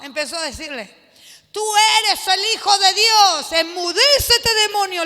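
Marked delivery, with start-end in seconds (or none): 1.5-5.0 loud commanding rebuke; stern rebuke. Retold or imitated. Jesus rebuking the demon, loud and commanding. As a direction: silence the demon with authority. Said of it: "le dijo Jesús".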